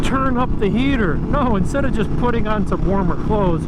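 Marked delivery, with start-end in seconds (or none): 0.0-1.2 high whiny mockery of heater talk; whiny mock complaint. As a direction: whine it like a spoiled complainer. Mocking people who complain about cold.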